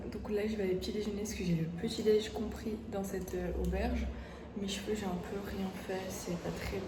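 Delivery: hushed whisper so sleepers stay asleep. Real, not acted. Hostel dorm, people sleeping nearby.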